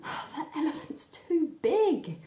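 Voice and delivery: put-on boy giving up on the too-small fridge, near tears; desperate. A narrator's reading. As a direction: wail it slowly in despair. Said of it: "said the boy desperately".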